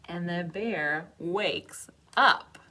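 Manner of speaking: loud dramatic. Acted. Storyteller booming as the bear wakes up. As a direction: announce the wake-up with weight.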